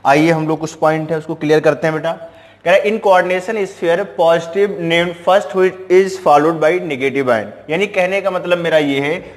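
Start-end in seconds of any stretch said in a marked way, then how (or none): 2.8-7.7 slow dictation. Shown as a demonstration. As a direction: read the English rule out very slowly. Teacher reads rule aloud to students. (धीरे-धीरे नियम लिखवाना)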